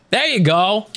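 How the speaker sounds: ordinary talk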